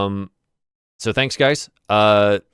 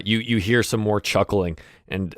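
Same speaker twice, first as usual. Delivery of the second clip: ordinary delivery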